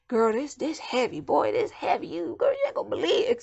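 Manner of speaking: put-on country accent building to a high shout. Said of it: "my accent started getting all country".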